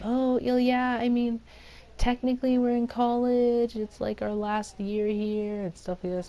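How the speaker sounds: put-on high voice making excuses; imitated teenage girl. Girl excusing her lie to the boys, high mimicked voice.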